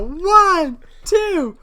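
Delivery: very high, loud count with a stretched finish; countdown shout. Pretend. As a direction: yell the count to a crowd, building to the end. Acting out the launch countdown.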